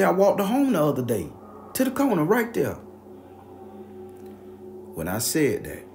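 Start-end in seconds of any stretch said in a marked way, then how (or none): 0.0-2.7 loud, high protest; acted insistence. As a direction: insist to doubters, as if re-living it. Insisting to disbelieving neighbors.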